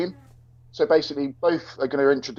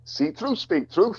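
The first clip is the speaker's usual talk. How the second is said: put-on a light, higher Welsh accent, playful; a hint of a Welsh accent, higher and teasing; mock Welsh accent; 7 semitones higher, slower than usual. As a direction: sing it out in a hammed-up Welsh accent. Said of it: "is that your Welsh accent?".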